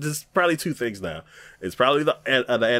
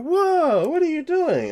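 alarmed; 13 semitones higher than usual. Retold or imitated. Alarmed man in retold scene.

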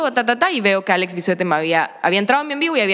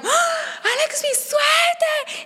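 very high, thin mock fan squeals; screaming fans; much slower than usual. Followers reacting to Alex in her live, mock squealing. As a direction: imitate a starstruck crowd, stretching the name.

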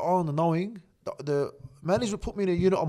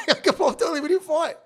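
laughing; 12 semitones higher, 4 dB louder than usual. Real, not acted. Laughing retelling his words.

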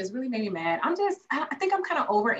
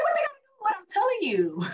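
indignant [name]; 10 semitones higher, faster than usual. Retold or imitated. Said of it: "And I'm like".

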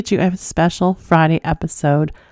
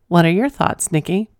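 ordinary delivery

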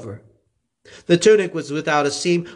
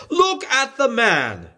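higher, slow and deliberate; Pilate presenting; 9 semitones higher, slower than usual. A narrator's reading. Pilate showing Jesus to the crowd, slow and higher. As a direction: let each word land slowly.

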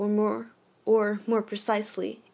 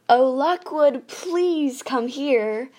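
louder, higher, slow sing-song call; 4 semitones higher, 8 dB louder, much slower than usual. A narrator's reading. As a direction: call down the landing, drawn out. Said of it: "called a little louder".

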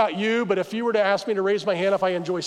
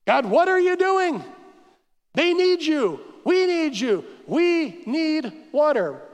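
high-pitched, desperate plea; acted cry for help; crying out to God; 6 semitones higher, slower than usual. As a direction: plead upward to God, stretching out the last words. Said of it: "Moses cried out to God".

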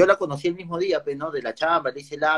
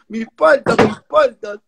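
much higher, louder whiny voice; mock whine; 8 semitones higher, 4 dB louder than usual. Streamer whining to chat about his avocados, high mock voice. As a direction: whine like a spoiled kid.

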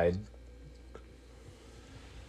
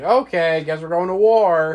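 resigned going along; 8 semitones higher, 8 dB louder than usual. Retold or imitated. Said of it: "And they're like".

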